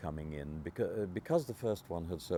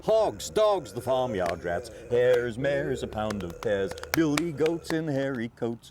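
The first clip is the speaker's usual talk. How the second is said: sing-song reading; 4 semitones higher, 8 dB louder than usual. Acted. Performing the children's rhyme.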